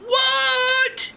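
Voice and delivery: very high, loud "what"; drawn-out comic shock; shocked bear parents. Acted. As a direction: shriek it in disbelief. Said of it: "Mama and Papa were like".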